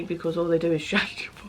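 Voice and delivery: whispered fast aside. Real, not acted. Whispering the cheeky bit to viewers. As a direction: drop to a quick conspiratorial whisper.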